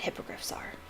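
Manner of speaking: hushed whisper of warning. A narrator's reading. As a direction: breathe it like a secret. Hagrid warning the class.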